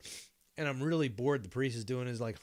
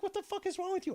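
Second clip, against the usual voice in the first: much higher, incredulous; exasperated squeal; faster than usual. Retold or imitated. Narrator baffled by his friend's silence, high and incredulous. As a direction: squeak it in disbelief. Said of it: "I'm like".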